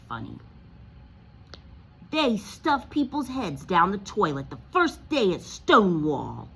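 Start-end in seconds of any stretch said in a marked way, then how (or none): 2.1-6.5 Dudley taunting. Acted. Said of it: "he told Harry".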